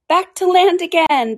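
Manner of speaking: high, loud, drawn-out call; excited cry. A narrator's reading. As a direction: call out the last dance step. Frenzied dance calls continue.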